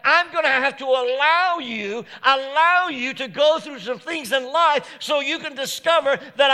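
loud, preached voice of God, lightly voiced; God speaking to Randy. Pastor voices God speaking to him, loud preaching voice. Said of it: "But God decided".

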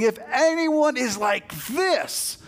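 high, drawn-out shout; shouted, pleading proclamation; urgent appeal. Acted. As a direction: cry out slowly to the whole crowd. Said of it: "he's appealing to them".

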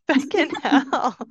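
laughing while echoing "Feckin' hell". Real, not acted. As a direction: giggle through the words. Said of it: "repeats feckin' hell, laughing".

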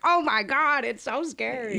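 high-pitched imitation of naive panic; mock naive alarm. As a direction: mimic your clueless, scared past self. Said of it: "there's a lot of naivete".

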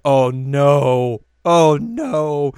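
loud, hammed-up panic; mock alarm. Friends reacting to Yui's bad news, loud mock panic. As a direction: cry it out like disaster struck. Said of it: "they're like".

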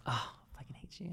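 a breathy whispered mock grudge; jealous whisper. Host jealous of Eureka touching Bryce, whispering. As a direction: whisper it under your breath, playfully jealous. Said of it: "I was like".

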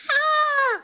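high-pitched pretend shriek; play-acted fright scream; mock scream. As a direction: let out a hammed-up scream of horror. Mock horror after episode title.

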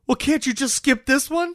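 high-pitched imitation of a whining husband; mock pleading. Husband begging wife to skip yoga, high mock pleading.

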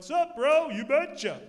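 high, loud "Sup, bro? You betcha."; kids calling goodbye. Retold or imitated. Kids calling after the departing visitors, loud and high. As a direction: shout it like proud kids showing off.

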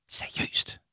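incredulous whisper; hushed, voiceless challenge. Acted. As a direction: whisper it softly in disbelief. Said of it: "hviskede Jama".

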